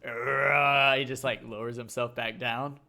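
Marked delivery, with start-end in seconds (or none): 0.1-1.0 loud mock roar; imitated monster roar. Imitating the clown's scare for a co-host, loud mock roar. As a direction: let out a drawn-out growly "arrgh". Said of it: "after he's done doing the".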